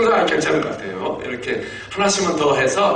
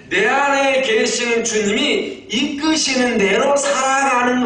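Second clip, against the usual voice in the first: slow, raised preaching voice with drawn-out words; preaching declaration; 7 semitones higher, slower than usual. Real, not acted. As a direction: proclaim it slowly and high, stretching key words. (길게 끌며 높여 선포하는 설교조)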